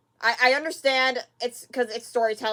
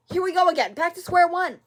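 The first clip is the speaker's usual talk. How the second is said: exasperated outburst; 6 semitones higher, 5 dB louder than usual. Real, not acted. Frustrated at the show's turn.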